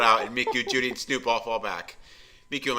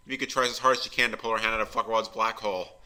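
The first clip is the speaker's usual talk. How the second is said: usual speaking voice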